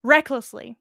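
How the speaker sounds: ordinary delivery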